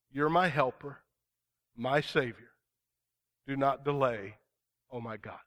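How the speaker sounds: preacher reading a prayerful psalm; fervent scripture reading. Acted. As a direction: plead the verses earnestly. Reads psalm aloud in sermon.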